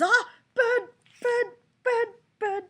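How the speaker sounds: very high, loud squawked cries; mock shrieking. Breaking off the bird report, mock shrieking. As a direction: yelp each word in a short falling burst.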